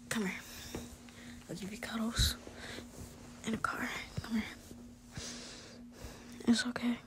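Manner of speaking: put-on whispered reassurance to a frightened girlfriend. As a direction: coax her to speak, gently whispering. Boyfriend cuddling and reassuring listener.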